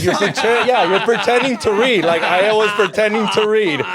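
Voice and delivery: high, amused, talking through laughter; laughing admission. Real, not acted. Admitting to fake reading in school, laughing as he talks. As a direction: own up to it while laughing, pitch lifted.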